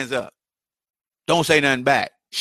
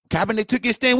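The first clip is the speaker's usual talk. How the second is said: ordinary delivery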